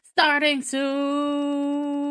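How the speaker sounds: high, endlessly held last word; long drawn-out. Pretend. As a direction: stretch "soon" out absurdly long. Comically stretches the screen's catchphrase.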